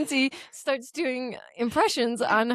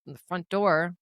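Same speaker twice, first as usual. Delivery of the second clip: ordinary talk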